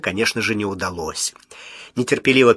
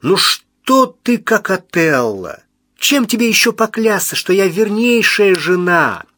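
indignant protest; 8 semitones higher, 5 dB louder, slower than usual. A narrator's reading. Wife protesting her faithfulness.

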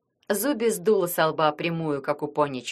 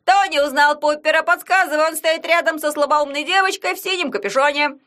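sports commentator; 8 semitones higher, 6 dB louder than usual. Acted. Yagun commenting the match.

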